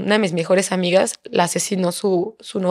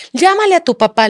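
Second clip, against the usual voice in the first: higher-pitched urgent call; retold frantic yell; 8 semitones higher, 4 dB louder than usual. Mother begging son to call for help, frantic retold yell. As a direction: re-enact yelling an order to your child. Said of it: "Empecé a gritarle como, como pinche loca".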